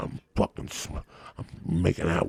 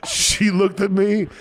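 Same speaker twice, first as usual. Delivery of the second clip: laughing; 10 semitones higher, 3 dB louder than usual. Real, not acted. Laughing at own story.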